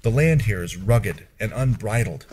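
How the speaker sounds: ordinary delivery, nothing marked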